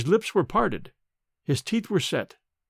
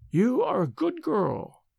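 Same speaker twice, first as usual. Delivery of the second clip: put-on old nurse; 7 semitones higher, slower than usual. A narrator's reading. Old nurse addresses the maid.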